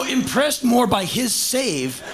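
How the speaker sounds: ordinary delivery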